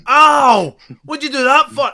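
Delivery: very high, loud hurt cry; pained yelp. Acted. Melgrim kicked by the player, hurt and indignant yelp.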